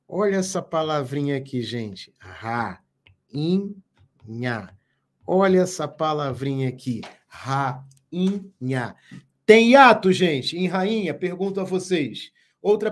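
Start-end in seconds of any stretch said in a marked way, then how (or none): 2.2-3.7 "Rainha" broken as ra... i... nha; low, separate beats for each syllable of "Rainha"; split into syllables. Shown as a demonstration. Teacher showing students a little word, "Rainha" syllable by syllable. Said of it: "Essa é a separação silábica". 7.2-9.0 "Rainha" in three beats, ra... i... nha; split into syllables. Shown as a demonstration. Teacher asking about hiatus in the word, "Rainha" split syllable by syllable. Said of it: "Essa é a separação silábica".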